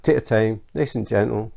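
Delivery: ordinary talk, nothing marked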